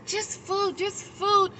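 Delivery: high-pitched imitated begging; persistent pleading. Panhandler persisting in asking for food, imitated insistent plea. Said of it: "she really persisted".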